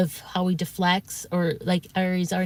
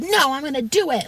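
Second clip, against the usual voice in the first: high-pitched imitation of a stubborn refusal; mock his mad insistence, pitched up; 7 semitones higher than usual. Streamer refuses the caller's reassurance, mock mad. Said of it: "when he got really mad".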